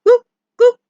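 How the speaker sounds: short high, loud woofs; two sharp imitated barks; dog barking. Shown as a demonstration. Teacher giving the Indonesian dog sound, barking high. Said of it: "Anjing bersuara".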